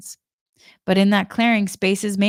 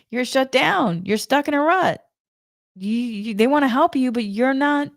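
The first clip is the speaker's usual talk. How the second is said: higher-pitched mock scolding; scolding psychic; 4 semitones higher than usual. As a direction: lecture her like a stern psychic. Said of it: "she went on to say".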